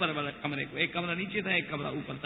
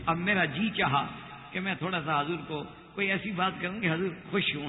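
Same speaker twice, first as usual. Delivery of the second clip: ordinary talk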